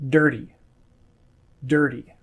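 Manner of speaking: dirty with the T tapped like a D; flapped T. Shown as a demonstration. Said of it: "sounds like a D instead of a T".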